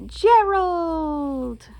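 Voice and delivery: eager shout across the yard; calling out. Acted. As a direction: call a friend's name with a big loud shout. Piggy calls out to Gerald.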